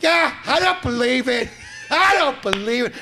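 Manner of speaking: high, loud mocking voice of a stubborn doubter; loud mock denial; mock disbeliever. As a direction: sneer it like someone refusing facts.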